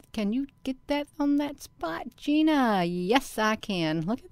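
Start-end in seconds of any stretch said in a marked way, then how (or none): 0.1-2.8 high, sing-out question to herself; playful self-questioning. Pretend. Woman asks herself to catch it on screen, playful high self-questioning. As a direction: pitch it up, coaxing yourself.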